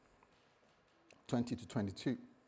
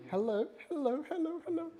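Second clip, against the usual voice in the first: sing-song falsetto, much higher; showing off the ring; 13 semitones higher, 4 dB quieter than usual. Retold or imitated. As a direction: flaunt the ring in a teasing high voice.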